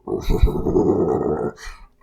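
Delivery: loud, very low rumbling laugh; hammed-up bottomless bass laugh; deep rumble. Shown as a demonstration. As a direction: let out a big deep rumble. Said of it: "I can go deeper".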